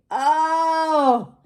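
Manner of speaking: high drawn-out grumpy "oh"; grumpy groan. A narrator's reading. Grumpy pirate grumbling as the parrot follows, high groan. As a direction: let out a long, high grumble. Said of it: "follows Gus around and echoes him".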